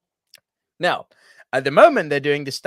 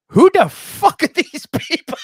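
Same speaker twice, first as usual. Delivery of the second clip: high, loud, slowed mock disbelief with a laugh; bewildered exclaim; 13 semitones higher, 7 dB louder, slower than usual. Host baffled by unknown celebrities, high laughing disbelief. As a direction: squeal out the confusion.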